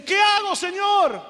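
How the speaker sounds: high, loud, eager question to heaven; acted excited plea; happy, relieved shout. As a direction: call out as the overjoyed climber. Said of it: "el hombre se pone contento y dice".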